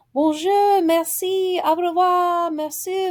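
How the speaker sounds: high, loud, sing-out shout of French words. A narrator's reading. Boy shouting to the big boys on the rock, high shout. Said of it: "he looked up at the group ... and shouted".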